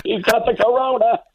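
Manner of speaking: high, accusing Southern voice calling him out; shrill it like a suspicious local spotting an outsider; mocking Southern locals. Guest imitating Southern locals accusing him, high mock alarm. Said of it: "And they're like".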